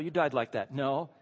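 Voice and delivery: ordinary talk, nothing marked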